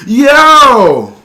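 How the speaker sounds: loud high yell; hype shout. Real, not acted. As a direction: yell it like an intro. Retaking the shouted intro.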